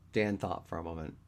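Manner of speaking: ordinary delivery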